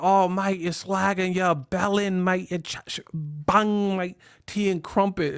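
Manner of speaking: cheeky fake London accent; mock English accent. Mocking how English people sound mad, fake English accent. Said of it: "like England".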